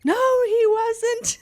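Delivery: high, loud, slow wail; a sobbing child refusing to believe; crying denial. Retold or imitated. Child brother denying, crying.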